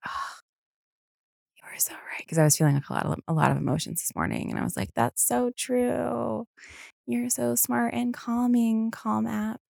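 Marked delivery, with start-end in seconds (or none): 0.1-2.2 soft whisper of awe; hushed agreement. Retold or imitated. To the meditation app, a hushed whisper. 5.0-9.6 higher, slow, sweet gushing voice; mock gushing. Praising the Calm app in a high, slow coo.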